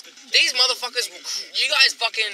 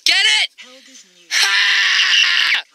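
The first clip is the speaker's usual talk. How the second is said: loud, high-pitched yelling; jokey shout; 10 semitones higher, 9 dB louder, slower than usual. Pretend. Yelling a crude chat-up pun at mates, loud jokey shout. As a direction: holler each bit, ending on a big shouted "ha".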